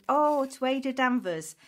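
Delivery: hyped giveaway announcement. Real, not acted. Drawing giveaway winner live.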